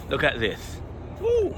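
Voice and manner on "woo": high delighted woo; sudden high excited cry; excited whoop. Real, not acted. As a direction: whoop with joy at the tree. Excited whoop at mango tree.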